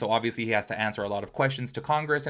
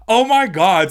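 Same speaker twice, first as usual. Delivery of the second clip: loud, higher-pitched "oh my God"; exasperated reaction; 6 semitones higher, 10 dB louder, slower than usual. Retold or imitated. Vlogger retelling her reaction to online hate, exasperated. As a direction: exclaim it in loud, retold disbelief.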